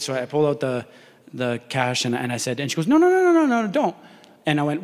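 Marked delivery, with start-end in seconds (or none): 2.9-4.0 pitched-up frantic no no no; high protest. Retold or imitated. Woman refusing the cash, high frantic protest.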